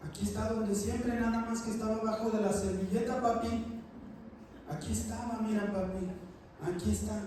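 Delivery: a small daughter gently showing papa; little girl. Retold or imitated. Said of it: "llega la niña más chica de la casa y dice".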